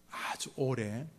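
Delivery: ordinary talk, nothing marked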